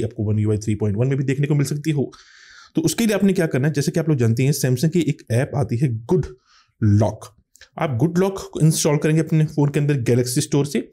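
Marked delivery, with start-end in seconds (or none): none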